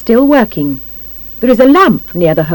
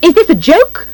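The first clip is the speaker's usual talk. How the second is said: high, sharp question; pitched-up, indignant challenge; 10 semitones higher than usual. A narrator's reading. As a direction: demand it indignantly. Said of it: "Missus Nosey says sharply".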